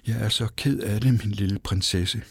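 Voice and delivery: plain reading voice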